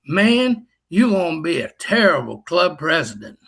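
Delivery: a mock Tennessee drawl, stretched out. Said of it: "in that Tennessee drawl".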